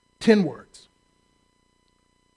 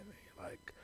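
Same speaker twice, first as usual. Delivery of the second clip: whispered aside; 32 dB quieter than usual. Real, not acted.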